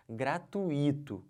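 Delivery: "Gratuito" slowly as gra-tu-Í-to; wrong stress on I. Shown as a demonstration. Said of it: "Não pode falar o quê?". ("Gratuito" errado, com tônica no Í)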